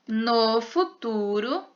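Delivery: usual speaking voice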